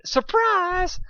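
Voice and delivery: high, loud surprise shout; crowd shout. Acted. Party guests jumping out at Dusk, shouting.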